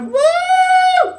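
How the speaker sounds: very high, loud mock crowd cheer; crowd whoop.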